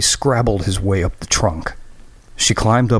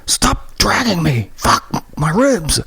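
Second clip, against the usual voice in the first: high, slow, hurting protest; pained yell; 9 semitones higher, slower than usual. Acted. Graham yelling as Christy drags him, in pain. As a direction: cry out against the pain.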